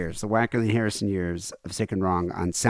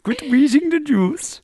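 high, loud, drawn-out comic squeal; goofy slow high-pitched impression; silly put-on voice; 6 dB louder than usual. Silly voice amid accent impressions.

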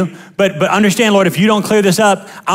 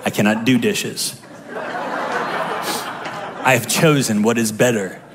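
much lower, soft, with laughter; mock pious husband; 10 semitones lower, 7 dB quieter, slower than usual. Husband dodging chores, mock holy and low. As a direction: play the smug saintly husband with a chuckle. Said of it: "a weapon in most husbands' hands".

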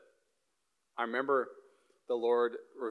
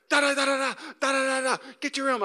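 high loud mock yelling dad; 13 semitones higher, 5 dB louder than usual. Imitating his father scolding over spilled water, mock angry yell. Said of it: "he'd be like".

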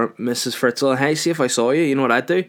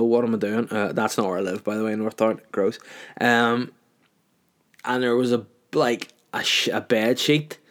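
ordinary talk

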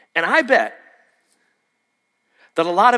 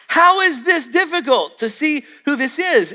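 exasperated; 6 semitones higher than usual. Retold or imitated. Retelling exasperation at guessers.

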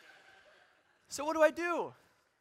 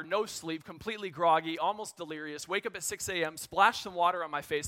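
ordinary talk

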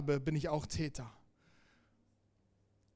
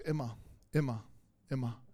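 a hint of a calm Jesus, lower and softer; gentle Jesus; 5 semitones lower, 5 dB quieter than usual. Jesus answering Peter about forgiveness, soft and low. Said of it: "Und Jesus sagt".

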